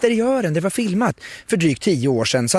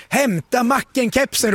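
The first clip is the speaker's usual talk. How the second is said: higher, drawn-out mock holler; imitated call-out; 6 semitones higher than usual. Reporter imitates Gustav calling to his wife, mock holler. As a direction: call it out slowly in a raised pitch. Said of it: "till hustrun och ropar".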